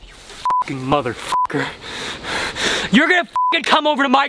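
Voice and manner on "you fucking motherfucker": low, slow furious grumble; low angry growl. Real, not acted. After being soaked.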